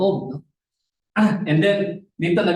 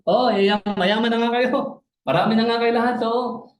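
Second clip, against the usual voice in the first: higher mock-impressed tone; lightly mocking, pitched-up praise; retold boasters' taunt; 7 semitones higher than usual. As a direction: act out a sarcastic concession. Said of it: "Yung mga nagmamayabang".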